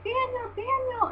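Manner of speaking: mock scream, high and stretched; imitated fan screaming. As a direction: squeal the name like a fan at a show. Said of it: "I started screaming".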